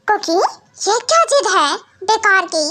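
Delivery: ordinary talk, nothing marked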